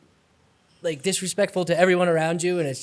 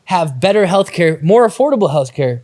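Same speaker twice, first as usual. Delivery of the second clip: upbeat, louder sponsor read; 5 semitones higher, 7 dB louder than usual. Acted. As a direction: sell it like a radio ad. Reads sponsor ad.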